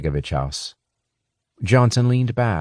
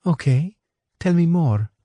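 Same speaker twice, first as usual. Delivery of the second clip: lightly voiced English woman, a touch higher; a hint of a polite English woman; Anna; 11 semitones higher than usual. A narrator's reading. Anna explaining to Johnson, lightly voiced.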